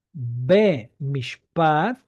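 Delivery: Be mishpat said very slowly and clearly; slow demonstration. Shown as a demonstration. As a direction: spell out Be mishpat word by word for the class. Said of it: "Si yo digo".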